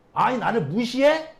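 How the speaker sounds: loud, slow, offended snap; indignant outburst. Retold or imitated. The Pharisees taking offense at the man, indignant. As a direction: bristle at being disrespected. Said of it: "벌컥 화를 냅니다".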